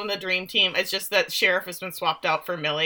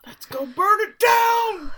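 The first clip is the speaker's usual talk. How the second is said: much higher, slow gleeful yell; shout; 13 semitones higher, 4 dB louder, slower than usual. Retold or imitated. Host shouting Bev's call to burn it all. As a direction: bellow it like a madwoman. Echoing the arson plan.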